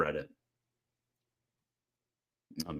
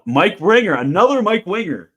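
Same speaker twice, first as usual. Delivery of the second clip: high, loud gleeful call-out; excited; 10 semitones higher, 11 dB louder than usual. Real, not acted. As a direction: shout the name out with delight. Spots a name in chat.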